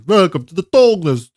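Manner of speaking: loud, high-pitched Russian-accented impression; Mosin Nagan, Russian accent. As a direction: belt out the catchphrase in a Russian accent. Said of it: "almost sounds like Zarya from Overwatch".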